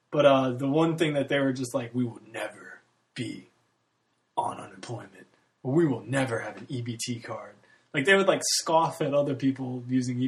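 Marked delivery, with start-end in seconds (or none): 1.9-7.5 stern parents. Retold or imitated. Parents voiced, scornful vow.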